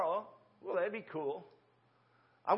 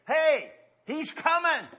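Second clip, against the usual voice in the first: 1 heraldic call; 7 semitones higher, 6 dB louder, slower than usual. Acted. Archangel's call voiced.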